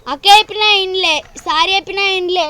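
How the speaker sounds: loud, aggrieved complaint; real heated grievance; indignant protest. Real, not acted. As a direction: protest hotly, punching each complaint. Child protesting that nobody listened.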